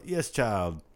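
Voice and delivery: lightly voiced TV psychic; hint of a psychic handing down a reading; playful mock fortune-teller; Miss Cleo. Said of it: "have any Miss Cleo feelings".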